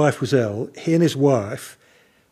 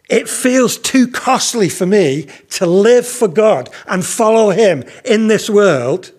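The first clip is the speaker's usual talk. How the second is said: slow, higher-pitched lament in his words; jealous lament; 6 semitones higher, 4 dB louder than usual. Retold or imitated. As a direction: voice his grievance, drawn out. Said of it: "it made him jealous".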